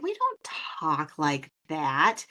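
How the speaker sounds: slow, stretched-out Minnesota vowels; mock Minnesota accent. Host insisting Minnesotans don't talk that way, in exaggerated Minnesota accent. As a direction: protest while leaning into a Minnesota accent. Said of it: "And I'm like".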